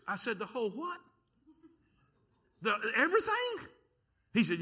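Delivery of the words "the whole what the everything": stunned disbelief. Retold or imitated. Son stunned by dad's order.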